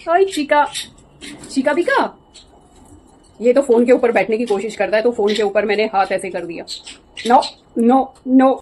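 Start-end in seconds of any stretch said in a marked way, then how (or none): 0.1-2.0 pet greeting. Real, not acted. Owner greeting her parrot, high playful baby talk. 7.3-8.5 louder insistent no-no; shooing the bird. Real, not acted. Owner shooing her parrot off the phone, louder insistent no.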